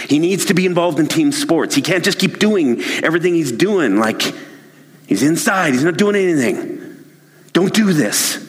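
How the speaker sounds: pushing his case harder and harder; heated insisting. Acted. Father argues with his wife, rising heat.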